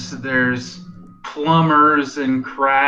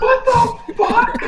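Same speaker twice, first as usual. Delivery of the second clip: high, drawn-out laughing squeal; incredulous laughter; 3 dB louder than usual. Real, not acted. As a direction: shriek it through real laughter. Cracks up at absurd line.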